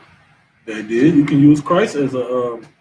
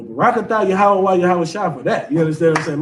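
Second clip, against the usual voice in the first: ordinary talk, nothing marked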